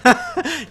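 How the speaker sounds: high laughing burst; a real amused laugh; burst of laughter. Real, not acted. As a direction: laugh out at the idea. Laughing at the question.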